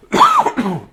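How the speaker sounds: big sudden sneeze; loud sneeze. Real, not acted. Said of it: "that was a big sneeze".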